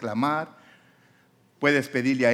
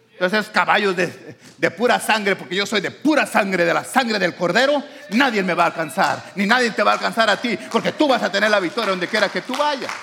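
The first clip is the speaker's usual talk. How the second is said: louder, higher climactic preaching; full-force preaching; 9 semitones higher, 7 dB louder than usual. Acted. Preacher telling the church no one will catch them, climactic preaching. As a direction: drive it home with fire.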